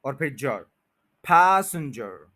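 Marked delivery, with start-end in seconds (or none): 1.2-2.3 passenger slow, first syllable leaned on; slow stressed demo. Shown as a demonstration. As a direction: stretch out passenger, stress the start. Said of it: "पी ए एस के ऊपर stress है".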